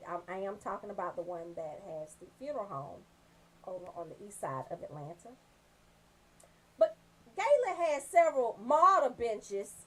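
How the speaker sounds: usual speaking voice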